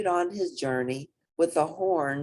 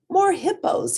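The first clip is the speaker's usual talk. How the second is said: put-on young hippo thinking; 13 semitones higher, 6 dB louder than usual. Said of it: "he thought".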